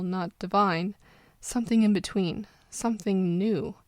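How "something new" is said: ordinary delivery, nothing marked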